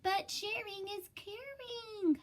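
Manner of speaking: high pleading protest; Clark crying out. Acted. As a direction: plead your case in a high voice. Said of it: "cried Clark".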